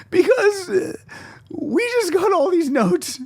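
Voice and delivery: high, slow, hammed-up weepy whine; imitating her crying. Mimicking the colleague crying over notes, high mock whine. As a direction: whimper it out like a tearful coworker. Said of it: "She's crying because of the notes".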